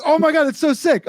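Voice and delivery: high, gushing mock excitement; excited fan quote. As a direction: gush like a thrilled fan. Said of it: "And he was like".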